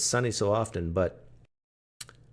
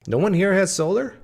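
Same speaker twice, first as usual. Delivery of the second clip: loud, high call-out to the chat; 10 semitones higher, 8 dB louder than usual. Real, not acted. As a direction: raise your voice to the whole room. Calling out to chat again.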